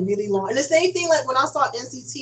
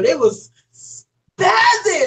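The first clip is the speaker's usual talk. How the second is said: excited; 8 semitones higher, 3 dB louder, much slower than usual. Real, not acted. Crowd going wild at BTS.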